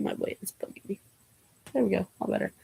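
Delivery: whispered under the breath; a quick whisper to herself; whispered aside. Real, not acted. Aside while fixing hair.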